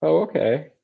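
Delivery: "Oh, okay" pitched high, politely flat; imitating a shrugging listener; mock polite indifference. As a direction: sound polite but uninterested. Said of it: "the other reaction that I usually get is".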